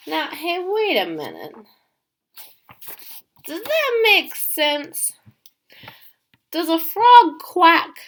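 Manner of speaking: squeaky mock outrage at a silly story; mock surprise. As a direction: play shocked for the kids. Teacher fakes disbelief at story.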